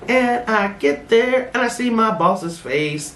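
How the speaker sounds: ordinary talk, nothing marked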